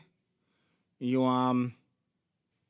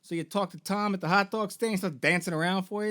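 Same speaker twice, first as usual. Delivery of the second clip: ordinary talk, nothing marked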